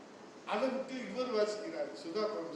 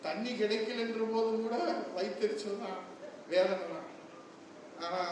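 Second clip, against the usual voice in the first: ordinary talk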